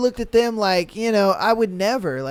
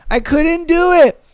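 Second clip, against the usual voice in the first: loud disbelief; 7 semitones higher, 5 dB louder than usual. Real, not acted. Reacting to cheating video.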